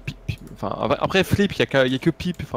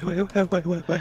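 talking while inhaling; 6 semitones higher than usual. Retold or imitated. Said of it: "putain, mais cette voix, les gens, tu sais, qui font".

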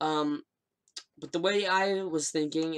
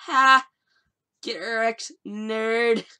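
mocking gloat; 6 semitones higher, 6 dB louder, much slower than usual. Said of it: "I'm like".

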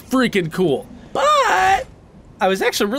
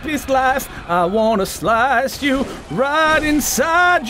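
slow, stretched-out mock threat; hammed-up taunting; 3 semitones higher, slower than usual. Drawn-out trash talk at boss.

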